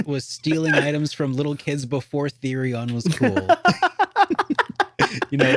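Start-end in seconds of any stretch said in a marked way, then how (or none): 3.5-5.3 sudden high burst of laughter; high-pitched laugh. Real, not acted. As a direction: break into a squeaky laugh.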